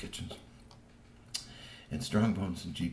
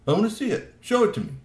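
much higher and louder, insistent; demanding troll; 12 semitones higher, 6 dB louder than usual. Acted.

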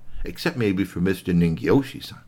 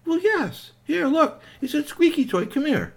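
high cheerful inventor voice. Acted. Cuttlefish voiced.

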